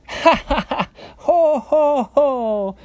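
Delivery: high, loud ha-ha then ho-ho; mocking storybook pig laughter; acted laughter. A narrator's reading. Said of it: "laughed the two little pigs again".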